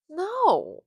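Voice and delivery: loud, high, emphatic denial. Acted. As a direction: blurt out a booming denial. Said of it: "sorry for raising my voice".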